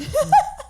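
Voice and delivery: short, high laugh at herself; high burst of laughter. Real, not acted. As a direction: laugh out at your own slip. Joke about almost cursing.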